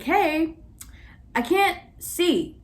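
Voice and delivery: student mocking the professor's forgetfulness, slow and pointed; mock exasperated. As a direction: say it like he's slow to understand. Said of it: "I got so upset".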